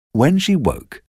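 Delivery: plain reading voice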